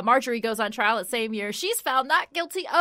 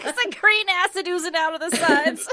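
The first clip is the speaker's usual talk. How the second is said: laughing; 7 semitones higher, 4 dB louder than usual. Real, not acted. Joking about poisoned cake, laughing.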